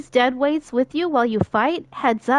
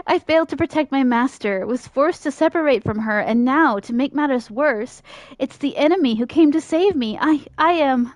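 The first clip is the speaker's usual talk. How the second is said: ordinary talk, nothing marked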